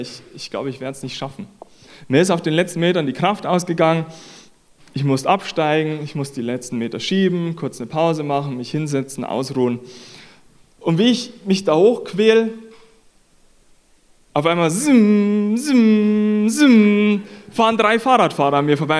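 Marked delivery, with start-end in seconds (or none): 0.0-1.5 much softer, lower voice of giving up; retold discouragement. Retold giving up on steep climb. 14.7-17.2 higher, drawn-out whizzing "zzzim" three times; imitated zooming past. Imitates cyclists zooming past him.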